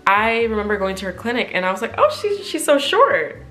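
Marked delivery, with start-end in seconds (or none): 2.0-3.4 thrilled gush. Retold or imitated. First seeing her therapist at the clinic, giddy high gushing. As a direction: squeal over how short and cute she is.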